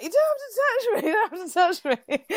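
very high, squealed indignation; hammed-up high whine, laughter creeping in; mock drunken protest. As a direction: squeal it as if being manhandled out. Acts out being escorted out drunk.

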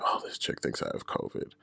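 hushed aside under the breath; whispered thought. Retold or imitated. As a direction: whisper it like a private realization. Private thought voiced at hospital.

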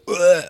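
loud mock retch; imitated retch. Woman beside them nearly vomiting at the spit, retching. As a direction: heave as if about to vomit. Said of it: "did, like, a vomit".

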